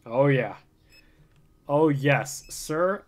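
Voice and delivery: ordinary talk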